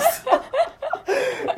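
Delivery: a high squeal of laughter; squeaky laugh. Real, not acted. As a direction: crack up high. Laughing at self.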